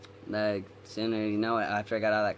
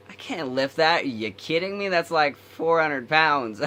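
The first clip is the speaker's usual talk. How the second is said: loud, high, acted disbelief; mock outrage, pitched up and dragged out at the end; incredulous protest; 6 semitones higher, 6 dB louder, slower than usual. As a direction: protest loudly as if it's absurd. Retold protest at a heavy weight.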